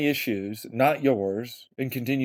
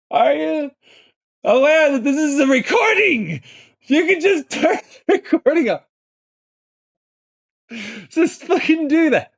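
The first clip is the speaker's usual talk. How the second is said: panicked shout; 14 semitones higher, 5 dB louder than usual. Real, not acted. Panicked pleading with the other voice.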